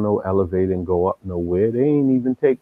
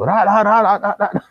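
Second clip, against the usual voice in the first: mock battle cry; 11 semitones higher, 3 dB louder than usual. Bursting out of the tunnel.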